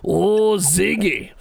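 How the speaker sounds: put-on promoter's accented call, drawn-out oh; Johnny Kim. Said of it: "He always called me Ziggy".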